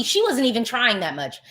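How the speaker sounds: ordinary delivery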